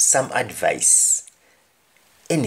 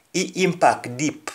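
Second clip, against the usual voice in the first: patois, raised pitch; Jamaican patois; 5 semitones higher than usual. Shown as a demonstration. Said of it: "Somebody says".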